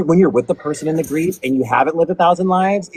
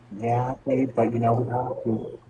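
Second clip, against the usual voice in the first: gentle mom voice; 3 semitones lower, 8 dB quieter, slower than usual. Retold or imitated. Mom answering child gently.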